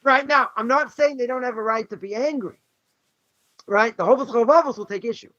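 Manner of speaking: normal talking voice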